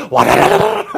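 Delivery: rattling, rolling tumble noise; imitated crash. Preacher mimics hundreds of dominoes collapsing, imitated crash.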